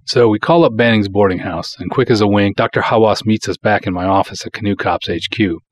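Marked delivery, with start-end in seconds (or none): none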